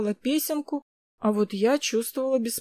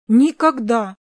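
loud emphatic repeat; firm, raised scolding; exhaled reproach; 8 dB louder, slower than usual. A narrator's reading. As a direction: drive the warning home. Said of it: "выдохнул он".

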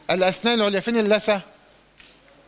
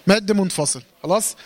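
usual speaking voice

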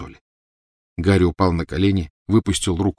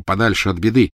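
ordinary delivery

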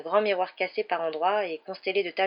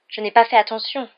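louder, solemn admission; grave; 4 semitones higher, 9 dB louder than usual. A narrator's reading. Mimi answers Harry about that night, gravely. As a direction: state it with gravity. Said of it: "dit Mimi d'un ton grave".

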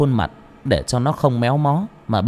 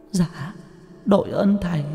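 much higher ghostly woman's voice, relieved; grateful ghost; 8 semitones higher than usual. A narrator's reading. Ghost thanks the exorcist, high and relieved. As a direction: thank him like a humble spirit. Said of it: "mừng lắm".

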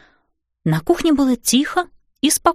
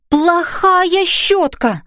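high, very drawn-out telling-off; scolding; 7 semitones higher, much slower than usual. A narrator's reading. Fairy mother to the naughty brush, scolding. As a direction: scold it slowly, pitched well up.

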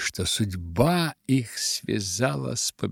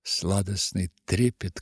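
ordinary delivery, nothing marked

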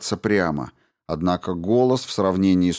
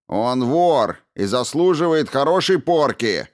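barking; 6 semitones higher than usual. A narrator's reading. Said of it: "рявкнул Брин".

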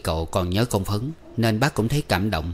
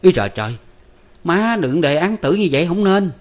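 much higher, louder startled cry; startled outcry; 9 semitones higher, 6 dB louder than usual. A narrator's reading. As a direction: blurt it out in alarm. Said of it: "giật mình la quảng lên".

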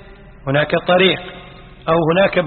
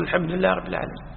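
quieter and quicker, murmured after the imam; follower's echo; 8 dB quieter, faster than usual. Shown as a demonstration. Teacher showing the worshipper echoing the imam, soft and quick. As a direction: echo it softly and fast, like the one behind. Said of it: "أنت تقول".

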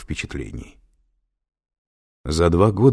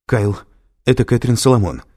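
hurried, high and loud; a hint of being out of breath; breathless blurting; 6 semitones higher, 6 dB louder than usual. A narrator's reading. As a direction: blurt out the name urgently. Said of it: "сбивчиво, задыхаясь, выпалила она".

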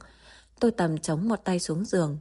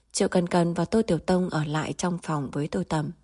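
ordinary delivery, nothing marked

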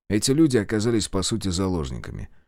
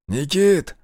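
raised call, pitched up; 8 semitones higher, 5 dB louder than usual. Acted. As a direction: call out to him across the yard. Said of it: "позвал Зотов".

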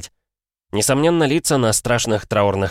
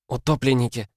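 put-on disgusted girl voice; 5 semitones higher than usual. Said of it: "с искренним отвращением выдохнула".

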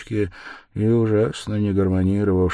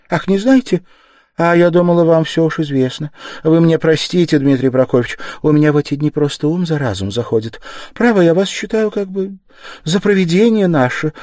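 higher, flustered, rushing in places; flustered mother, voice raised; gushing mother; 10 semitones higher, 8 dB louder than usual. Acted. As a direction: pour out gratitude and worry.

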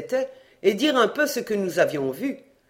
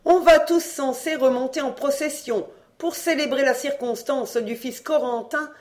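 bossy commanding mother; 8 semitones higher, 4 dB louder than usual. A narrator's reading. Mother's speech to assembly.

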